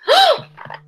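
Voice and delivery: sharp high-pitched gasp of alarm; a high startled gasp; big high gasp. Acted. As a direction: gasp as the horror dawns. Trixie realizes bunny is wrong.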